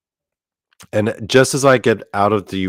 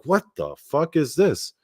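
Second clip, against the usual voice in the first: horrified; 7 semitones higher, 4 dB quieter than usual. Retold or imitated. Self quoted, horrified in dream.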